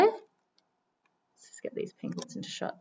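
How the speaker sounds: slow whispered aside; whispering. Real, not acted. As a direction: hush it while framing shot. Framing penguins on camera.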